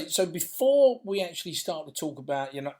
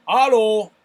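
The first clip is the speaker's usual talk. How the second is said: hallo belted out, louder and higher; loud German hallo; 4 semitones higher, 14 dB louder than usual. Shown as a demonstration. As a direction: bellow hallo like a proud German. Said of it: "that's a lovely one".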